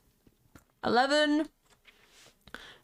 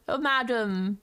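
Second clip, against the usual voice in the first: ordinary delivery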